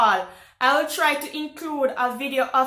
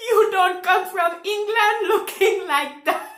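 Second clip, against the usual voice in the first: higher voice breaking out of a laugh; laughing quote; 5 semitones higher, 3 dB louder than usual. Retold or imitated. Quoting the French lady to viewers, laughing in disbelief. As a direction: quote it through incredulous giggles.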